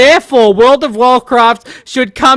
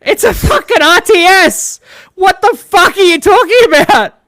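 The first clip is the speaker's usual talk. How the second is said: exasperated; 7 semitones higher, 4 dB louder than usual. Real, not acted. Exasperated rant at video.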